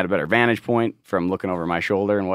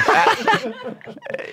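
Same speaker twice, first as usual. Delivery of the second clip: squeal. Pretend. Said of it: "let's hear your best squeal".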